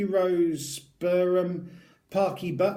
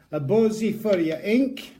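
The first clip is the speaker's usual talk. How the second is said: hammed-up Scottish ad voice, a little higher and louder; bad Scottish accent; 4 semitones higher, 4 dB louder than usual. Vlogger reading the product slogan aloud, in a bad Scottish accent. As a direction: sell the tattoo butter in a mock Scottish accent. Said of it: "the world's worst Scottish accents I've had to ever do".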